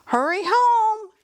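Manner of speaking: high, calling mother voice. Acted. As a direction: call it out high like a mom. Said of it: "his mom says".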